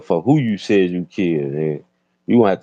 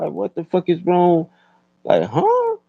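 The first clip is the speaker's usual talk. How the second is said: very high disbelieving huh; incredulous; 12 semitones higher than usual. Real, not acted. Ranting about the dude, high shocked huh. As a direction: squeal out the disbelief.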